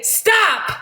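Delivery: high, firm, emphatic command; big boy voice. Acted. Son to a touchy teacher, big boy voice. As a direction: voice a child forcefully refusing. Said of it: "use his big boy voice".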